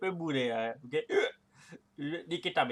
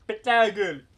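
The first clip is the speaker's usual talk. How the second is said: loud, punchy taunt; loud threat; 5 semitones higher, 10 dB louder than usual. Real, not acted. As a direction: call it out loudly at an opponent. Threatening the opponent in game.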